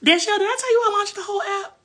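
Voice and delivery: high, fast and thrilled; excited. Real, not acted. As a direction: burst out to a friend. Sees friend in chat, excited.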